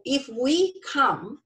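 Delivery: ordinary talk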